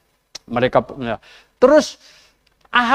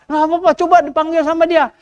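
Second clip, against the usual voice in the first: higher, coaxing king voice; Yosafat urging; 8 semitones higher, faster than usual. Retold or imitated. Yosafat tells Ahab to summon the prophet, coaxing. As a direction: nudge gently, call him anyway. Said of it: "Yosafat bilang apa".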